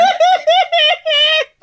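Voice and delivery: shrieking burst of laughter; loud high laugh. Real, not acted. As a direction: crack up helplessly. Storyteller cracks up.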